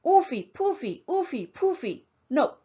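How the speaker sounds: piggy voice. A narrator's reading. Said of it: "But the piggy answered".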